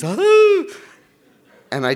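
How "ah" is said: high, loud mock scream; imitated shrill panic cry; panicked scream. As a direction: yelp like a child surfacing in panic. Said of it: "panicked and he's".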